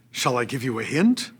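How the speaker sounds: normal talking voice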